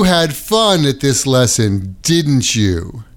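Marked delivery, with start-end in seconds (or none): 2.0-3.0 "didn't you?" dropping low at the end; falling tag. Shown as a demonstration. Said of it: "the tag question will have falling intonation".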